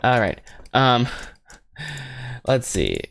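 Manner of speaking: normal talking voice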